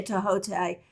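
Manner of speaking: "Tahote" as tuh-HOH-tay, three syllables; Egyptologists' pronunciation. Shown as a demonstration. Teacher quoting Egyptologist friends' usage, "Tahote" as tuh-HOH-tay. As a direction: say "Tahote" with a sounded H and final "tay". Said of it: "my Egyptologist friends all say".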